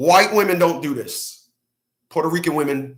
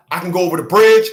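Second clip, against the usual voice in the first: usual speaking voice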